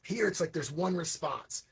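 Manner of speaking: usual speaking voice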